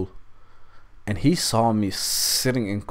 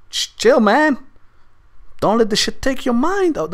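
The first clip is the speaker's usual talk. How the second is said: high, loud pep talk to himself; urgent self-talk; 13 semitones higher, 4 dB louder than usual. Retold or imitated. Stoned man telling himself not to panic, urgent. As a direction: talk yourself down in a rising panic. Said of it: "what I was saying to myself".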